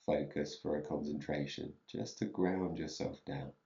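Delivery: soft, unhurried meditation voice with long pauses; guided meditation. Real, not acted. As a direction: ease them gently into stillness, leaving space between phrases.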